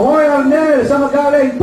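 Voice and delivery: wife demands where he's been; wife shouting back. Retold or imitated. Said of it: "Sabi ng asawa ko".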